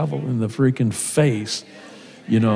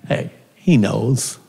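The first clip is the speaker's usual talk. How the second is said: ordinary delivery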